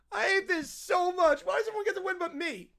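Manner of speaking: hammed-up childish tantrum; whiny kid. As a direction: whine bitterly like a losing child. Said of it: "peak whiny kid territory".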